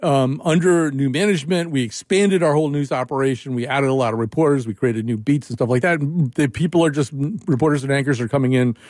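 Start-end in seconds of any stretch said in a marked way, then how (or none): none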